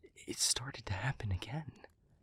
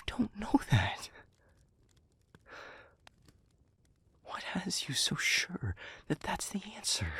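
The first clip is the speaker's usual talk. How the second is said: pitched up and urgent, pleading against it; distressed protest; 5 semitones higher than usual. Acted. Speaker refuses to watch partner walk into harm, distressed protest. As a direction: argue desperately, voice climbing high.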